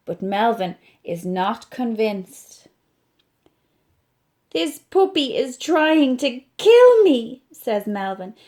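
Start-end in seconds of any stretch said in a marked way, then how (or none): 4.5-7.4 loud, panicky complaint; a frightened monkey voice; alarmed. Acted. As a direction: cry out as if in danger. Melvin panicking about the puppy.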